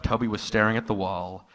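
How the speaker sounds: ordinary talk, nothing marked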